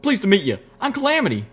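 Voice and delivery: loud, high folksy introduction; Calamity. A narrator's reading. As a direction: introduce yourself heartily, pitched high. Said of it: "Calamity took her in both his forehooves and shook vigorously".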